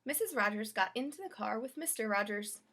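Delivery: usual speaking voice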